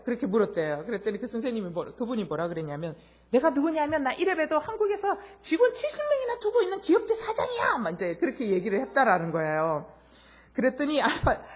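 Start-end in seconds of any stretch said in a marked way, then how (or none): 3.3-7.8 high, loud boastful boss voice; a company boss bragging loudly to a waiter. Retold or imitated. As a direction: puff up like a big shot showing off.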